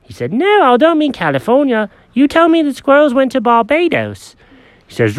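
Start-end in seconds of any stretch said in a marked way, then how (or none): 0.3-4.4 high, fussy cat voice; Jenkins the cat. Acted.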